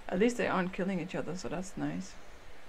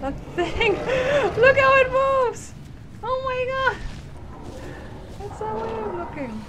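much higher, much louder excited squeal; much higher giddy squeals; squealing delight; 7 dB louder than usual. Real, not acted.

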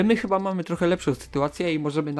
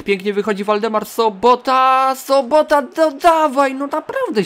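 climbing higher and louder; excited urging; 7 semitones higher, 6 dB louder than usual. Real, not acted. Gamer urging Sobota through on goal, excited urging. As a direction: cheer the runner on, building.

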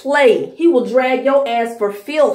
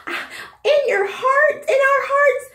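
high, syrupy mocking fan voice; mock sappy fan; 9 semitones higher than usual. Vlogger mocking a K-pop stan, in a high syrupy voice. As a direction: coo it like a gushing fan, pitched up. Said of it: "You're just like".

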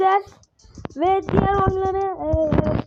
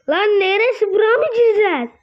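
loud, raised-pitch protest; loud disbelief; 4 semitones higher, 7 dB louder than usual. Real, not acted. Player balking at where he must enter, loud disbelief. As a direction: shout it in alarmed disbelief.

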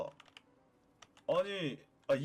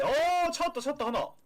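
excited; 7 semitones higher, 5 dB louder than usual. Real, not acted. Finds hidden item.

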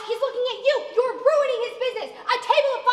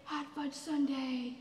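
dreamy trance; 6 semitones lower, 15 dB quieter, slower than usual. Acted. Dazed food trance.

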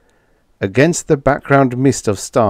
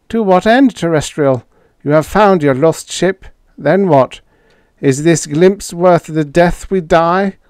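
higher alien voice, incredulous; alien Schla; 8 semitones higher, 4 dB louder than usual. A narrator's reading. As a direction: play the alien in disbelief.

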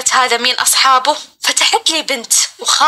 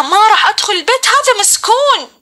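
pleading mock scream, well above usual pitch; crying, screaming refusal; 7 semitones higher than usual. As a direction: act out a desperate cry without much volume. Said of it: "وأبكي وأصرخ فيها".

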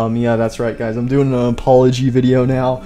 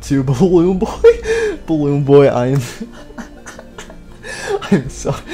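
laughing mid-apology; 2 semitones higher than usual. Real, not acted.

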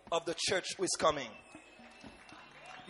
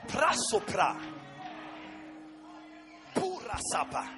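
fervent tongues over music; speaking in tongues; 4 semitones higher than usual. Real, not acted. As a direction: pour out ecstatic syllables.

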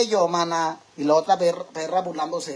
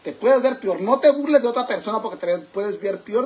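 loud scolding; 5 semitones higher, 5 dB louder than usual. Real, not acted. Scolding the mocking friend.

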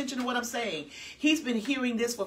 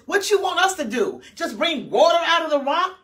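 loud, high furious retort; angry Moses; 7 semitones higher, 7 dB louder than usual. Acted. Moses mocking the grumbling people, angry shout. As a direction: hurl it at the crowd in rage.

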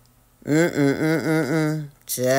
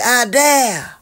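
indignant mock outrage; 7 semitones higher, 5 dB louder, much slower than usual. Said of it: "he said".

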